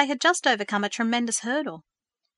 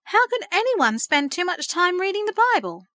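much higher, a pained protest; dismayed; 9 semitones higher, 3 dB louder than usual. A narrator's reading. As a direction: plead with disbelief. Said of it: "Verity was dismayed".

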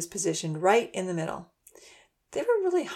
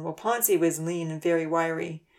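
ordinary delivery, nothing marked

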